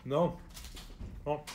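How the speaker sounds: speaking between bites; talking while chewing. Real, not acted. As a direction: mouth partly full. Seen 1: Eats pepperoni at -4; jaw working, lips pursed until ~1.9.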